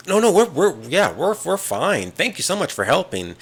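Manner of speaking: mock-flustered high voice rushing to deny; flustered brush-off. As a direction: play it lightly, a nervous Wanda waving it off. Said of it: "and she's like".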